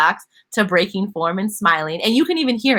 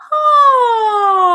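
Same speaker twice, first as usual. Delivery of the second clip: high, loud open "Ah!" with the mouth wide; 13 semitones higher, 7 dB louder than usual. Shown as a demonstration. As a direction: belt a bright high "Ah!".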